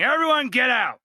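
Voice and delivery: high, loud call to get out; shouted warning. Retold or imitated. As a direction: yell it over the chaos. Moonlight yells warning to group.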